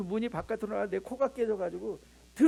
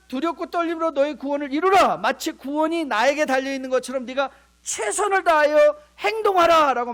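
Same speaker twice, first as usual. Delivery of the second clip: louder, higher solemn proclamation; emphatic scripture; 5 semitones higher, 9 dB louder than usual. Real, not acted. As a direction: proclaim the verse with force. (힘있게 선포하는 말씀 인용)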